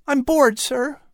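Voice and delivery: put-on high boy's voice, restless; a bedridden boy wanting to be well; bored Patrick. A narrator's reading. Patrick, child voice.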